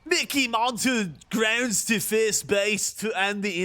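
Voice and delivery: mock British sports commentator, slow; plummy British play-by-play, drawn out; hammed-up British announcer; British commentary. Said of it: "Celebratory British commentary".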